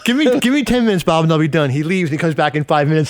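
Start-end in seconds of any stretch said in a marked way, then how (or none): 0.0-1.7 high, stammered mock-desperate begging; exasperated plea. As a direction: plead for time, pitched way up. Retold plea to impatient boss.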